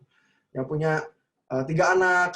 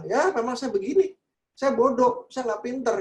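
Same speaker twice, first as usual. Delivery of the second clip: a higher, mildly acted child's voice owning defeat; resigned child; 8 semitones higher, 4 dB louder than usual. A hopeless child quoting himself, higher and resigned. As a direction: play a child who has given up on himself.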